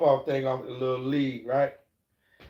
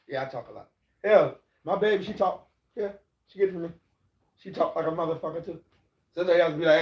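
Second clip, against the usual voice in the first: ordinary talk, nothing marked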